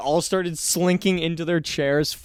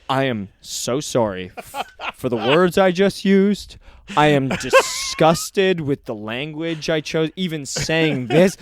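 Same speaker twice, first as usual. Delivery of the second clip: slow, solemn backpedal; mock earnest apology; slower than usual. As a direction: apologize slowly like it's a press statement. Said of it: "And then he just goes".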